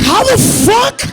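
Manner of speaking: high, loud, drawn-out yell; stunned outburst. Acted. Son reacting to his parents' love, screamed disbelief. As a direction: holler it in total disbelief.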